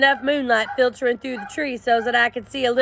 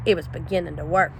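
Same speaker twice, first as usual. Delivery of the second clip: ordinary delivery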